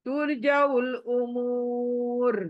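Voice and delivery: उमूर stretched slowly, final र silent; drawn out stop. Shown as a demonstration. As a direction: pull the long ू of उमूर and stop. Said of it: "रू को साकिन बना दूंगी".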